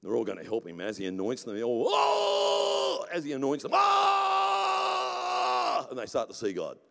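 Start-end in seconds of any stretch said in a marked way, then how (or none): 1.9-3.1 high, loud drawn-out "oh"; ecstatic cry. Acted. Elder being anointed by Moses, crying out in mock ecstasy. 3.7-5.9 high, loud drawn-out "oh"; ecstatic cry. Acted. Elder being anointed by Moses, crying out in mock ecstasy.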